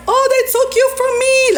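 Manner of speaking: high, mock-heartbroken wail; mock whiny lament. Mocking Max in a high fake-heartbroken wail. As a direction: whine it high like a jilted lover. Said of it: "Like, I was trolling".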